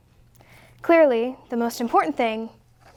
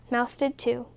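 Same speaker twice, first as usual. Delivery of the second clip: ordinary delivery, nothing marked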